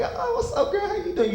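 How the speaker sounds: high crooning pickup line; smooth flirting.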